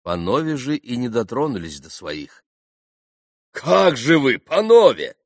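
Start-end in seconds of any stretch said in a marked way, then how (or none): 3.5-5.2 loud, high protest; indignant exclamation. A narrator's reading. Mitya seeing the gentlemen refuse to drink, exclaiming. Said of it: "воскликнул Митя".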